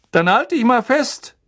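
high, loud conductor's call; Schaffner Stan. A narrator's reading. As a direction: shout it high, like a conductor warning passengers. Stan kündigt die Weiterfahrt an. (hoher, lauter Ruf des Schaffners)

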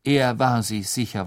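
ordinary delivery

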